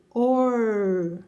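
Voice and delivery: OR stretched with a retroflex R; caipira R. Shown as a demonstration. Teacher reading O plus R, caipira R in "or". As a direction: hold "or" on a curled-tongue R. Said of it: "vai fazer o som caipira".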